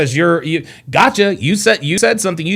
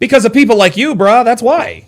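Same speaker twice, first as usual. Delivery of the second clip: louder, higher, indignant comeback; raised-voice accusation; heated retort; 7 semitones higher, 5 dB louder than usual. Real, not acted. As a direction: fire back at the speaker on screen. Talking back heatedly at clip.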